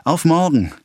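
high, loud farewell call. A narrator's reading. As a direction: call across the distance. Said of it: "rief durch die Weiden hindurch".

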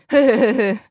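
the mighty count roars with laughter; big loud ho-ho-ho of a burly count; laughing shout. A narrator's reading. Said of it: "schrie der herkulische Graf".

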